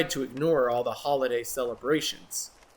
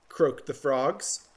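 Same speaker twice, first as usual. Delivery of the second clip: plain reading voice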